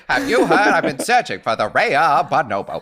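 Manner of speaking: put-on laughing old Hollywood transatlantic voice; transatlantic accent. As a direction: classic movie star accent through laughter. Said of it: "this transatlantic thing".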